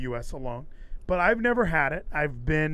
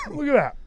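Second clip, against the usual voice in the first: sudden dry cough; real cough; 11 semitones higher, 7 dB louder than usual. Real, not acted. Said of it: "I'm coughing now".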